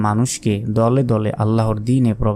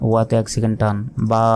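ordinary delivery

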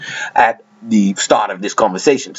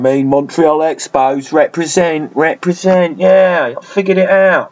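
South London accent; 6 semitones higher than usual. Shown as a demonstration. Cheering on the Montreal Expos in a South London accent. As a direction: hype it up in South London, repeating represent. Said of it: "back to Southeast London".

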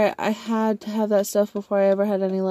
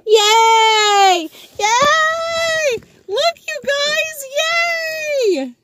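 high, drawn-out squealed yay; excited cheering; 5 dB louder, much slower than usual. Real, not acted. Cheering at sighting of tow truck.

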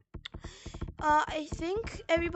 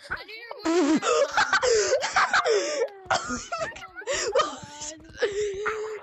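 hysterical laughter; 4 semitones higher than usual. Real, not acted. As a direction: laugh till you scream.

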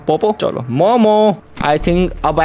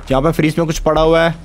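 ordinary talk, nothing marked

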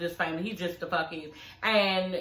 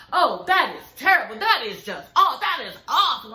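hammed-up dismay, louder; milk the outrage for TV; mocking fake reality-TV outrage; overdone shock; 3 semitones higher, 5 dB louder than usual. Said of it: "He really starting to overdo it".

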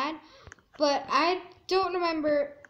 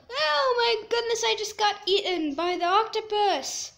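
shocked outburst; 5 semitones higher, 5 dB louder than usual. Real, not acted. Game character got eaten.

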